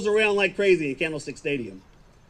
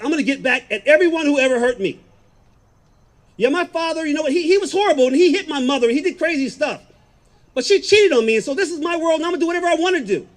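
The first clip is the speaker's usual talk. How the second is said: loud, higher-pitched vengeful rant; retold anger; 5 semitones higher, 5 dB louder than usual. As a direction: relive the fury, louder and higher, building a case for payback. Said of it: "I'm still so freaking angry".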